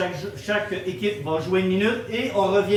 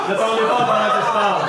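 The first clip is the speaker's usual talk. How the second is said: scolding; 4 dB louder, faster than usual. Real, not acted. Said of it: "Je devrais jamais dire des choses horribles".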